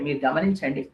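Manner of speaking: ordinary talk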